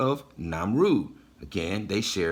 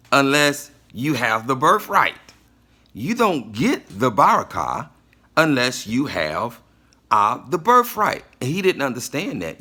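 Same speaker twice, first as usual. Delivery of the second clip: loud, punched key words with deliberate breaks; emphatic preaching; 6 dB louder than usual. Real, not acted. Preacher explaining Esau's error to listeners, forceful and emphatic preaching. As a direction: drive the point home forcefully.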